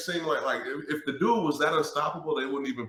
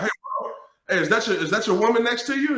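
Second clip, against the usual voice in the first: center's comeback; 9 semitones higher, faster than usual. Retold or imitated. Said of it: "And the center's like".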